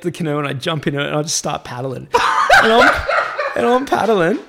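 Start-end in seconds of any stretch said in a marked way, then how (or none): 2.1-4.4 loud, high, laughing narration; laughter bubbling through the words; laughing while telling. Real, not acted. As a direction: crack up while telling the escape.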